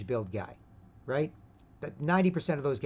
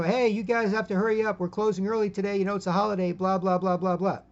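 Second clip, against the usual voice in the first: mild mock yell; 6 semitones higher, 5 dB louder than usual. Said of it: "she yells into the men's change room".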